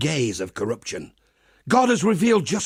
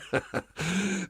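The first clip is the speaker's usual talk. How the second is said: quiet chuckle; 3 semitones higher, 10 dB quieter than usual. Acted. Chuckle at divine loving.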